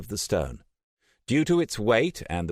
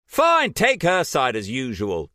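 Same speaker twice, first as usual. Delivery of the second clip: higher, louder bitter shout; 8 semitones higher, 6 dB louder than usual. A narrator's reading. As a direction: Trish lashing out at Jason. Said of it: "Trish shouted at him".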